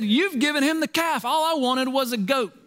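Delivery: high, whining complaint; whiny son. Acted. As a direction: whine it like a sulking son. Son's complaint voiced high.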